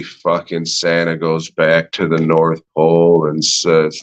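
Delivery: ordinary delivery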